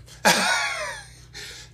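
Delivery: high squealing laugh. Real, not acted. Big laugh.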